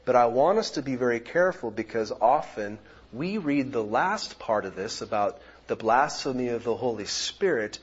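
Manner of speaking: normal talking voice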